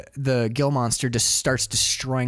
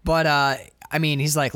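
normal talking voice

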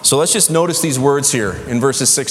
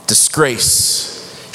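ordinary talk, nothing marked

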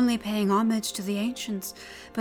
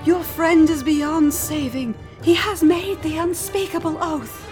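dire warning; 9 semitones higher, 8 dB louder than usual. A narrator's reading. Priestess tells Pig her friend is lost, calling out. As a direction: cry out the terrible verdict.

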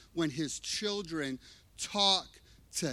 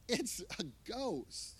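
hushed fearful murmur; 3 semitones higher, 9 dB quieter, slower than usual. Acted. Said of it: "They cried out".

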